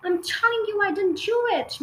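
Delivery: put-on high, desperate girl's scream. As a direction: shriek the denial. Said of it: "Matilda screamed".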